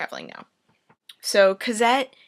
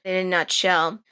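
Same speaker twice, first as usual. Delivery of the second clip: ordinary talk, nothing marked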